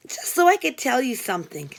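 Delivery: ordinary delivery, nothing marked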